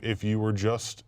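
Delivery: ordinary talk, nothing marked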